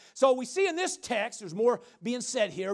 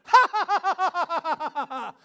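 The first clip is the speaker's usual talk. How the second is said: big staccato ha-ha laugh, high then falling; mocking laughter; 8 semitones higher, 6 dB louder than usual. Shown as a demonstration. Believer laughing at the devil's lies, loud mocking laughter. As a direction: laugh loudly in the devil's face. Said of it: "You should start laughing".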